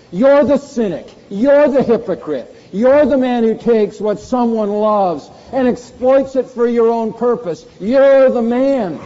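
loud, high, slow, every "You're" hit hard; angry accusation. Acted. Nathan confronting David over his sin, furious and loud. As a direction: hurl each charge at him. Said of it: "I made him sound angry".

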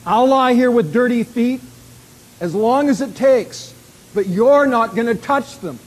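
loud, high, adamant protest; forceful acted refusal, each word driven home; blurted refusal. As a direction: dig in and refuse at full voice. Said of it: "So he blurts out".

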